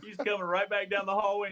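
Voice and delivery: high, giggly retelling; can barely get it out for laughing. Real, not acted. Telling friends about the manager storming back, laughing.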